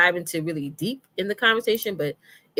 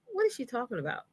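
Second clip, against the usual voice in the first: softer, higher puzzled aside; puzzled client; 6 semitones higher, 7 dB quieter, faster than usual. Retold or imitated. Coach imitates LaShawn getting the email, puzzled aside. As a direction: wonder it quietly to yourself. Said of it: "LaShawn gonna be like".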